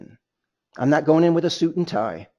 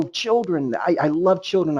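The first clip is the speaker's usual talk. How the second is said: ordinary delivery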